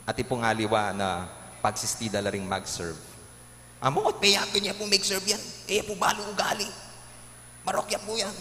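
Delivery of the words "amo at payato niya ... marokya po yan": high-pitched mimicking of a gossip; mock critical parishioner. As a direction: imitate a grumbling churchgoer, pitched well up.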